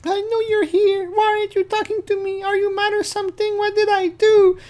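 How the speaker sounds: high falsetto, anxious and pleading; needy girl voice. Retold or imitated. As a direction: whine like a neglected girlfriend. Reading Kayla's chat messages.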